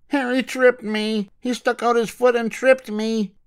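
high, slow whiny complaint; tattling little girl. Acted. Matilda complains to mother that Harry tripped her, whiny. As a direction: tell on her brother.

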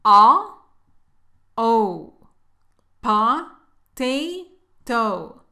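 loud "A" as uh, then "o" as oh, then "Pa, te, to"; isolated vowels, then syllables "Pa", "te", "to"; two different O sounds. Shown as a demonstration. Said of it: "la pronunciación es diferente".

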